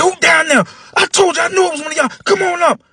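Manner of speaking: put-on high, loud angry old man shouting. Old man catching the boys, angry high shout. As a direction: yell down the stairs like a furious old man.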